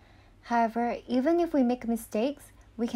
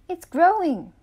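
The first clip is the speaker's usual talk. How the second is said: higher, louder delighted exclamation; excited; 7 semitones higher, 8 dB louder than usual. A narrator's reading. Girl shows mom her sprouting bean, excited.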